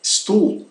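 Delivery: "Stuhl" wrongly said "stool", with plain s; wrong plain-S version. Shown as a demonstration. Teacher ruling out another mistake, wrong plain-S pronunciation. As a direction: begin "Stuhl" on s, no sh at all. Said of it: "nem tão sequer".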